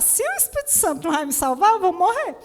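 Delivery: high, loud despairing cry; panicked. Retold or imitated. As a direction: wail that you are going to die. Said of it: "Foi a única coisa que eu consegui pensar".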